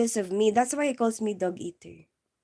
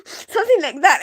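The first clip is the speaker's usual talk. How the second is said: high teary squeaking laugh, held in; stifled laugh; 6 semitones higher, 8 dB louder than usual. Shown as a demonstration. Showing the stifled laugh at work, squeaky laughter. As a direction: demonstrate the choked laugh you made. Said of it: "all I manage is".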